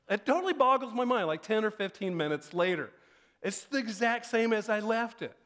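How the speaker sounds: ordinary delivery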